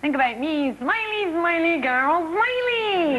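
put-on high, sing-song girly Scottish presenter; bubbly high girly TV voice. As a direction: coo like a perky breakfast host. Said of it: "She's a girly".

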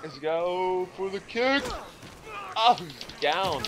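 very high, loud hype yell; ecstatic shout. Real, not acted. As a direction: celebrate the big move. Landing a combo.

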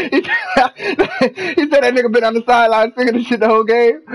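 high laughing voice, fast; laughing through words. Real, not acted. Streamer reading a fan's comment, laughing hard and fast. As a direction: rattle it off while cracking up. Laughing while retelling a chat message.

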